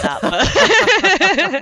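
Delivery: high, loud embarrassed laugh through the words. Real, not acted. Guest mortified after naming Essex words, laughing loudly. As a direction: blurt it out laughing, mortified, slowly.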